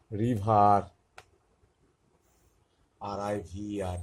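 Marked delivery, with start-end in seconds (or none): 0.1-0.8 রিভার said with an ah ending, loud; teacher models রিভার clearly; ah sound. Shown as a demonstration. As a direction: demo of রিভার with ah vowel. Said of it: "ইয়ের উচ্চারণটা আয়ের মতো আসছে".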